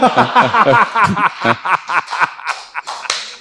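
long breathy laugh. Real, not acted. Host laughing.

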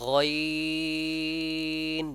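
গয়িন held long and level, three alif lengths; গয়িন drawn out for three beats; drawn-out ghayn. Shown as a demonstration. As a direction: stretch গয়িন slowly on one steady pitch. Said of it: "বলার সময় তিন আলিফ পরিমাণ টানবো অবশ্যই।".

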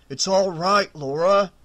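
loud, firm, steady; firm reassurance. A narrator's reading. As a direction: hold her and calm her firmly. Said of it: "he said firmly".

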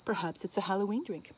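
normal talking voice

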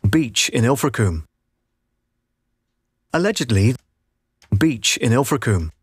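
usual speaking voice

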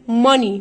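ماني as "MUH-nee", punched out; loud, clear "MA-nee"; M straight to N. Shown as a demonstration. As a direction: model ماني with a short vowel, no o. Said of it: "من الام للان على طول".

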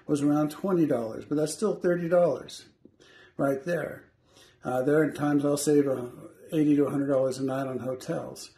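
ordinary talk

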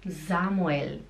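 "Samuel" starting with voiced Z; Z-sound demonstration. Shown as a demonstration. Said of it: "ele faz som de Z".

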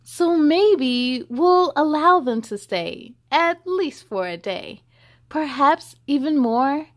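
put-on lively read-aloud to children; story reading. A narrator's reading. Reading the hippo book to children, lively storybook voice. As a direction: read it bright and sing-song for kids.

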